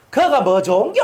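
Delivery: high, loud sneering retort; loud mocking put-down from a neighbour; dismissive scoff. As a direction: scoff at the idea from the side. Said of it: "이리 딱 나와버리면".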